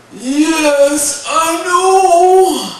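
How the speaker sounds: high, loud, drawn-out giant reply; self-satisfied giant. Acted. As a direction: boom it out, pleased with yourself. Giant Wendigo replies, flattered.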